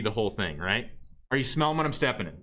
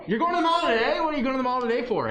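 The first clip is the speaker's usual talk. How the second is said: mock surprise; 10 semitones higher, 4 dB louder, faster than usual. Real, not acted. Teacher teasing a student.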